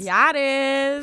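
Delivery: drawn-out, high, cheering agreement; high celebratory whoop of a reply. Real, not acted. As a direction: cheer on a friend's good news, stretching it out. Cheering friend's healed bone.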